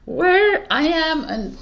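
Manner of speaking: giggly hyper. Real, not acted. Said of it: "caffeine addict mode".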